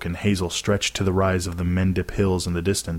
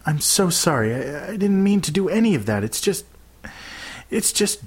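high, faltering, upset plea; tearful apology; 10 semitones higher than usual. A narrator's reading. Girl who has been crying apologises to her friend, tearful. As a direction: stumble through an apology, close to tears. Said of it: "She'd been crying".

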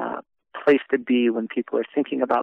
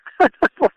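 short high disbelieving laugh; a burst of stunned laughter, high; incredulous laugh; 7 dB louder than usual. Retold or imitated. Trent told he is the last hope, high stunned laugh.

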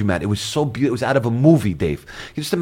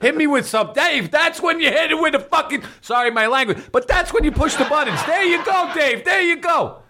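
high, hammy stand-up comic voice; brash vaudeville comic. Acted. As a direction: play it like an old Catskills comic. Comic bit, rimshot banter.

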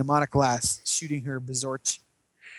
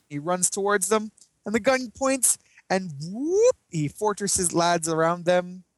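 usual speaking voice

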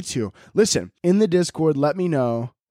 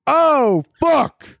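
startled curse; 12 semitones higher, 6 dB louder than usual. Real, not acted. Sudden realisation of a cost.

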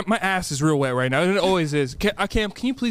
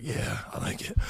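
whispered; 11 dB quieter than usual. Retold or imitated. Retelling his own reaction.

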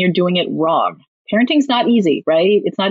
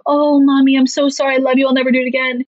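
higher-pitched imitation of a child apologizing; apologetic child; 4 semitones higher than usual. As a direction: gush sorry like a pleading kid.

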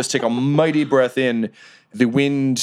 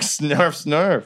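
higher, snuffling goblin noises; goblin snarf; 6 semitones higher than usual. Acted. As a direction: sniff like a little goblin. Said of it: "Goblin Junior's like".

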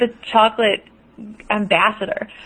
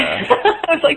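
laughing through the words; 8 semitones higher, much slower than usual. Real, not acted. Host laughing while recalling her panic over the show.

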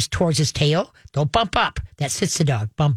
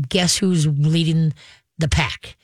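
normal talking voice

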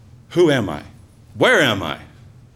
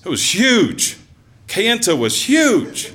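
amazed; 9 semitones higher, slower than usual. Real, not acted. Awe at first sight of town.

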